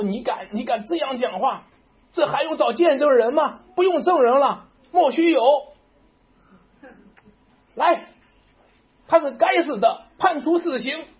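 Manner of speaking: high, loud, furious accusation; furious high priest. Retold or imitated. High priest condemning Jesus, furious and shouting. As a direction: hammer out the death sentence in rage. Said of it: "他生气了，他恼了，他火了".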